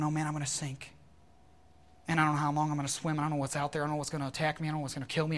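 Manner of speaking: ordinary talk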